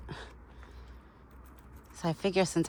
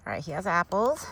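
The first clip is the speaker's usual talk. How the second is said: normal talking voice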